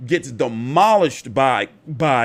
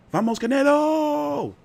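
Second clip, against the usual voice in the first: high, drawn-out Spanish cheer; hammed-up Spanish rallying cry; Spanish accent; 13 semitones higher, slower than usual. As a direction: cheer like a Spanish-speaking fan, slow and high.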